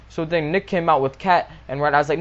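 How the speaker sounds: ordinary talk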